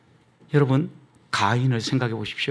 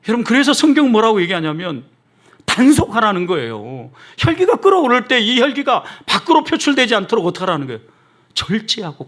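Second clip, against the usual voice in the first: raised, insistent sermon delivery; higher-pitched, emphatic preacher's warning; forceful preaching; 7 semitones higher, 5 dB louder than usual. Real, not acted. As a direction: drive the warning home from the pulpit. Preacher presses scripture's command.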